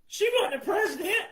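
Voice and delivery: high, loud laughing exclamation; incredulous outburst. Real, not acted. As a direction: burst out high in disbelief. Laughing at Kamala signing off.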